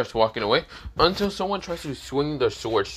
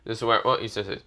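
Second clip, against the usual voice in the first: ordinary delivery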